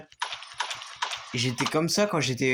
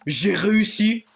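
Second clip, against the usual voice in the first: high, loud elated cry; triumphant relief; 5 semitones higher, 4 dB louder than usual. Real, not acted. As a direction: shout out the escape. Escaped the monster.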